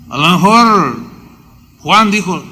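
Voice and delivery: normal talking voice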